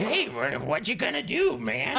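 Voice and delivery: high, squeaky goblin, half laughing through it. Acted. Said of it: "Do the goblin voice".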